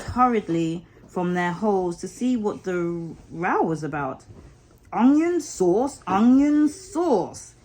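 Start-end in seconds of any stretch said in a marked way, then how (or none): 4.9-7.5 slow, higher, louder taunt; jeering. Acted. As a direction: drawl the taunt at the rabbits. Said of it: "he remarked jeeringly".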